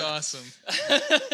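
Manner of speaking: laughing. Real, not acted. Friend reacting to the teacher story, laughing. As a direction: laugh it out, delighted.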